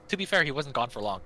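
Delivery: normal talking voice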